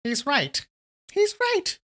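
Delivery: very high-pitched eagle-like character voice; really high voice. A narrator's reading. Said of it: "I'm gonna give him a really high voice".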